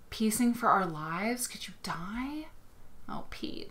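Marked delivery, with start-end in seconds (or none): 1.5-3.7 hushed, slow whisper; awed whisper. Real, not acted. Host marvels at a quilt photo of Pete, awed whisper. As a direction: whisper it in wonder.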